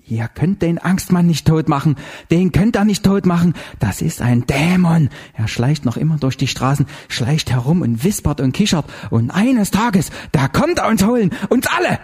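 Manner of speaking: high, building dread; hysterical raving. A narrator's reading. Zinzendorfer warns the couple of the demon, hysterical. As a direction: start agitated and climb to a high, frantic end. Bleeding neighbour raves about demon.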